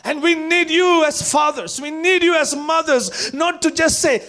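loud, high, driving sermon; full-force preaching. Acted. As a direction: preach it to the whole church.

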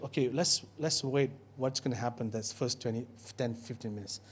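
hushed nervous office murmur; hushed waiting aside. Retold or imitated. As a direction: say it under your breath like nervous staff. Staff muttering while waiting.

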